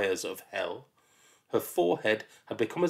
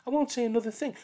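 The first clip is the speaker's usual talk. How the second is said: put-on whiny child; 14 semitones higher, much faster than usual. Child protests.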